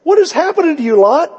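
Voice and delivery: high, loud disbelieving shout; a real outraged outcry; incredulous cry. Real, not acted. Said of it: "Does that sound weird?".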